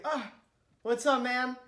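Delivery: loud, high yelp of a greeting; startled greeting. Retold or imitated. Front desk worker blinded by sun greets someone, startled. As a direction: blurt it out, caught off guard.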